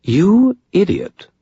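high, slow British disdain; precise British accent. A narrator's reading. Vampire boss rebukes Maroon, precise British accent. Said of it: "in a precise British accent".

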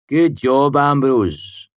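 loud, high mock congratulation; sarcastic. A narrator's reading. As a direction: jeer with false praise. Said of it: "Willem said sarcastically".

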